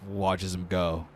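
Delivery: normal talking voice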